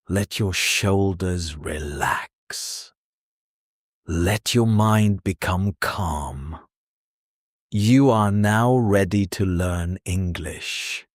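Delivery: hushed guided-breathing read; guided relaxation. Acted. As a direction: talk them down into calm, gently. Guided relaxation before lesson.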